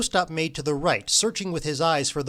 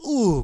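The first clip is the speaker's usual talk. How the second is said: high, louder drawn-out groan; sinking in dismay; group groan; 5 semitones higher, 6 dB louder than usual. A narrator's reading. Chess players capsizing, groaning together. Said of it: "groaned the chess players".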